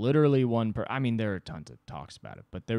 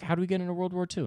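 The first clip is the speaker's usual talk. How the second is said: fast, higher eager question; mock eager boss; 9 semitones higher, much faster than usual. Boss voiced asking his team, fast and high. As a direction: rush the question like a keen boss.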